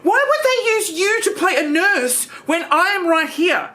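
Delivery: indignant Trish. Acted. Trish outraged over nurse role.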